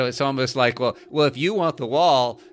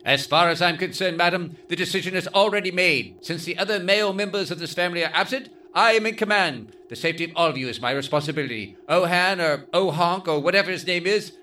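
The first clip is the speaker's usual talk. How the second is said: pompous, higher commanding voice; Doctor Smith; 5 semitones higher than usual. Acted. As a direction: declare it with mock authority. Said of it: "announces with a tone of authority".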